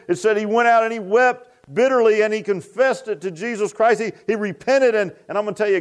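high-pitched, driving sermon delivery; fervent preaching. Real, not acted. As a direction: preach it with rising fervor.

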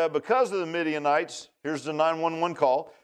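normal talking voice

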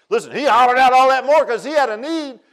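high, loud holler from the pulpit; full-force preaching. Real, not acted. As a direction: burst out hollering to the congregation.

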